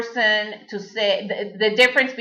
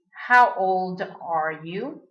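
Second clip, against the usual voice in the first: normal talking voice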